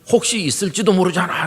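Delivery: high-pitched, comic comeback; mock protest. Cornered man's comic retort, then laughter. (궁지에 몰려 높게 항변하는 흉내)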